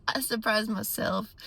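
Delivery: laugh, then repeat it amused; chuckling at your own surprise; amused self-surprise. Real, not acted. Laughs, repeating her own remark.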